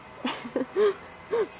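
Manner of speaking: short high laugh. Real, not acted. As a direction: break into a brief laugh mid-reading. Reader laughs mid-poem.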